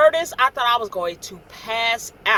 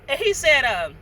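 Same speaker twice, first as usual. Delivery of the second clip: ordinary delivery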